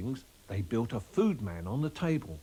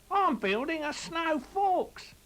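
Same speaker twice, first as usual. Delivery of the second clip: much higher, slow little cartoon rabbit; squeaky rabbit voice; 3 dB louder, slower than usual. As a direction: squeak it out like a small excited rabbit. Narrator voices Chris Rabbit.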